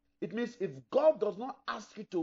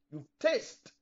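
ordinary talk, nothing marked

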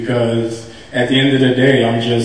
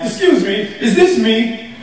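giddy high question; 9 semitones higher than usual. Retold or imitated. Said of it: "I'm like".

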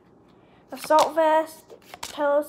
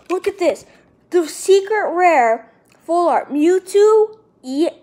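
excited shout; 5 semitones higher, 9 dB louder than usual. Real, not acted. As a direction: announce the card as if you can't believe it. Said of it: "getting too excited on camera".

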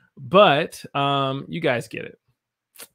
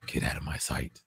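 low hushed whisper; whispered dismissal; 10 semitones lower, 9 dB quieter than usual. Shown as a demonstration. Teacher showing a sad way to dismiss someone, whispered. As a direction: breathe it out under your breath.

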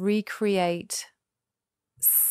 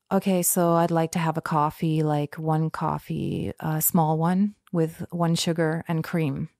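put-on American accent; 3 semitones lower than usual. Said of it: "I learnt the lingo".

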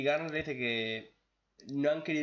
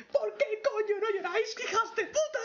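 shrieking falsetto yell, much higher and louder, laughing; mock shout; 4 dB louder than usual. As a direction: scream it high through laughter like a cartoon. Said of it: "Y le dice". (grito burlón en falsete, muy agudo, entre risas)

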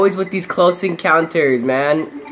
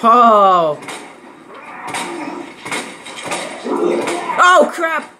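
startled yelps; 9 semitones higher, much slower than usual. Real, not acted. Jump scare while playing.

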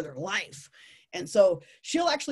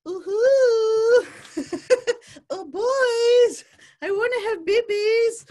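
high, loud sing-song cooing; flirty pheromone call; 10 dB louder, much slower than usual. Acted. Talk to visitors as female tarantula's perfume, hammed-up flirty call. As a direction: coo it out high and loud, hammed-up come-hither. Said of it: "the pheromones are like".

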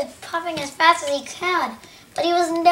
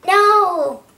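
drawn-out "no", higher and louder; dismayed cry; 4 semitones higher, 5 dB louder than usual. Acted. Percy facing doom from Thomas, dismayed cry. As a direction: cry out in protest.

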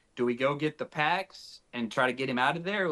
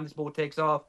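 ordinary talk

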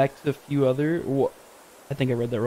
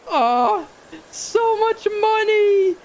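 high, slow, stretched-out cry of shock; 3 dB louder, much slower than usual. Real, not acted. Player seeing his debt, high stunned squeal. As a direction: squeal it out in disbelief.

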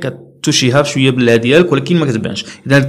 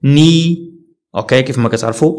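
usual speaking voice

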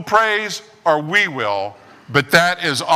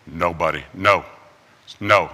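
a low warning from the stone; talking rock; 10 semitones lower than usual. Acted. Talking rock warns stone-thrower.

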